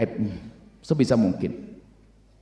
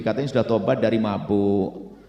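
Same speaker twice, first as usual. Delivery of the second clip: normal talking voice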